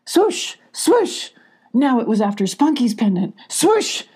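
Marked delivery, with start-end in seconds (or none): 0.0-1.3 repeated high swooshing; high whoosh sounds. Acted. 3.5-4.0 high swooshing wind noise; high whoosh sound. Acted. Tornado rushing by, high whoosh.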